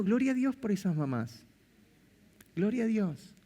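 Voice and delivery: ordinary delivery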